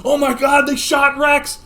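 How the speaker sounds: high, loud cry of shock; hammed-up panic at a shooting; alarmed shout. Friends seeing Rex shot, alarmed shout.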